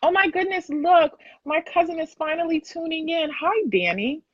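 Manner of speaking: a high, happy burst of surprise; light up as your cousin appears; delighted surprise. Real, not acted. Host thrilled to see her cousin tuning in, high delighted greeting.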